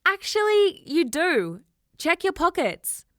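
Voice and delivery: very high, loud call; loud teasing call; shouting through window. Acted. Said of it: "shouted through the window".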